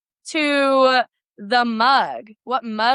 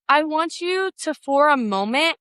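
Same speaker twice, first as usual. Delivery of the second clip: normal talking voice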